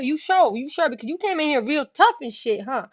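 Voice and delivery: high-pitched, pushy questioning; acted-out taunting challenge; challenging him. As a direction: press him in a retold confrontation. Retold challenge to boyfriend.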